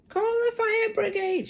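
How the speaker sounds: put-on very high, loud panicky old hag shout; shouting Grandma. A narrator's reading. Grandma panics and shouts for the fire brigade. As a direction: yell it like your stomach's burning. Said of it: "she shouted suddenly".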